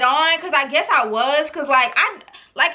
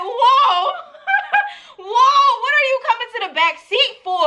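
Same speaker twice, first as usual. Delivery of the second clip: startled protest; 8 semitones higher than usual. Retold or imitated. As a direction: object in amused alarm, pitched high. Retold shock at driver's plan.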